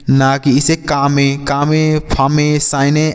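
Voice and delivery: कामे, फामे, साइने with the final e sounded as "-ay"; wrong reading. Shown as a demonstration. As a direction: misread कामे, फामे, साइने in two syllables each. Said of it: "ऐसे कुछ नहीं पढ़ा जा रहा है ना".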